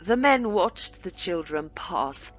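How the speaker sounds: plain reading voice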